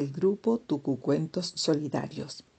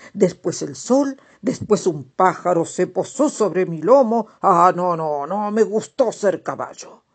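storybook toad voice; 6 dB louder than usual. Acted. Toad recounts horse dream.

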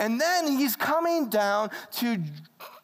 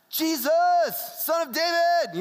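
mock Pentecostal; 8 semitones higher, 4 dB louder than usual. Shown as a demonstration. Showing how the blind man didn't say it, mock Pentecostal. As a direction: parody a Pentecostal worship cry, stretching the words. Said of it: "it's not that Pentecostal thing".

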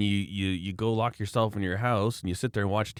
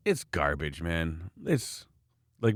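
ordinary talk

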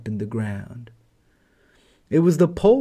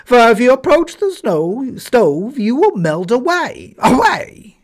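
much higher and louder barking dog; yard dog voice; 10 semitones higher, 8 dB louder than usual. Acted. Yard dog barks at the snowman to stay away, high and loud. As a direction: bark the warning like an old yard dog. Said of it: "said the yard dog".

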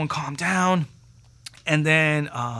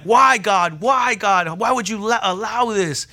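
raised, louder mock wail; imitating distraught kids; 6 semitones higher, 5 dB louder, slower than usual. Kids crying to God over drowning friend, imitated anguish. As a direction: plead upward like upset children, without real tears. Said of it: "they're crying".

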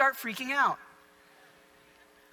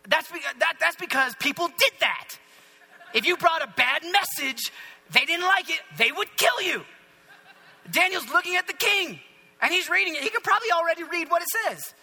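high, driven preacher's delivery; forceful preaching; 7 semitones higher, 4 dB louder than usual. Real, not acted. Preacher stressing the danger Daniel faced before the king, forceful. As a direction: preach it with raised pitch and urgency.

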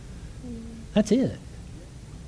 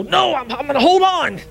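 high, stubborn voice of the darkness refusing to go; mock defiant darkness; 8 semitones higher, 3 dB louder than usual. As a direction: play the darkness digging in its heels.

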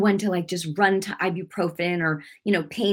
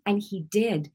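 ordinary delivery

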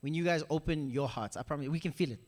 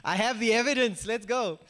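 louder, pumped-up cheer; excited; 3 semitones higher, 5 dB louder than usual. Retold or imitated. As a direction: celebrate the win out loud. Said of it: "I was like".

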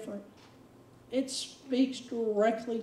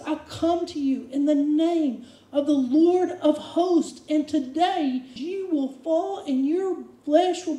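slow, loud, raised battle cry; David's defiant challenge; 3 semitones higher, 5 dB louder, slower than usual. Acted. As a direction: call out to the giant with full faith. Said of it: "And he says".

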